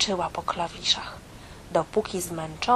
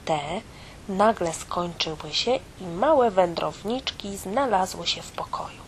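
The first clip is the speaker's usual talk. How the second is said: usual speaking voice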